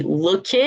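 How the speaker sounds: "looked" as look-id, rising; the wrong way. Shown as a demonstration. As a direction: give "looked" an extra syllable. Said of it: "the wrong way".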